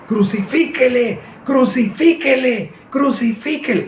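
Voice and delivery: high, drawn-out mock crowd chant; mob shouting. Preacher imitating the crowd that condemned Jesus, high mob cry. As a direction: cry it out like a baying mob, stretched and high-pitched. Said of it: "los que gritaron".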